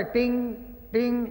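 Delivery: softly imitating a single plucked string; sitar twang. Uncle plucking one sitar string, mimicked twang. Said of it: "એક જ તાર વગાડે".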